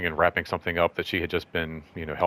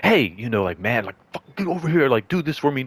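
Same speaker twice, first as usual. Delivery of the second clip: rude demanding customer; 3 semitones higher than usual. Retold or imitated. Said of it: "didn't seem like any kind of".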